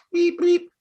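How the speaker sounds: high imitated phone beeps. Imitating a phone ringing before the cold call, high beeps. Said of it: "we've all get these phone calls".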